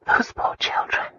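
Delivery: slow, pained whisper. A narrator's reading. Wife whispering in horror at the murders. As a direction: breathe the words out in sorrow. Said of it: "Christina whispered".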